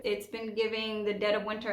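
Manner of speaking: normal talking voice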